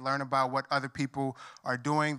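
usual speaking voice

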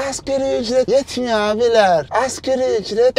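high-pitched put-on complainer voice; mocking whiner. Vlogger imitating minimum-wage complainers, mocking.

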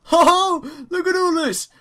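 high, loud, jubilant exclamation; gleeful excitement. Real, not acted. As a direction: crow over a lucky find. Finds a rich seam in game.

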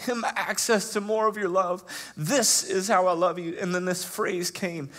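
preacher voicing God's love; impassioned preaching. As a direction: proclaim it tenderly but strong.

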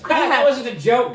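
high, loud prankster gnome; gleeful gotcha. Acted. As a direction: burst out with the prank's payoff. Gnome springs his gotcha.

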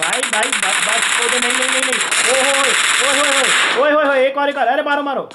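high, loud, rapid-fire repeated cries; excited yelling. Real, not acted. Streamer urging players on in a fight, excited yelling. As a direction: yell urgently as the action peaks. Frantic live reaction to game action.

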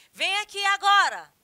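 loud, high shouted command; ordem gritada. Shown as a demonstration. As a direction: order someone over at full voice. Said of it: "aí eu mudo a entonação". (ordem em voz alta e aguda)

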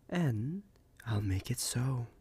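normal talking voice

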